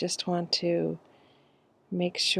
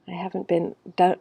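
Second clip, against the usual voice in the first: ordinary talk, nothing marked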